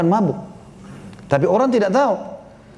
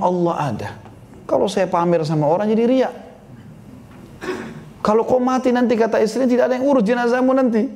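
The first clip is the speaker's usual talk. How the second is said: high, anxious pleading; worried wife; 7 semitones higher than usual. Acted. Wife pleads with her husband, high and anxious. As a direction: beg him to think of his name.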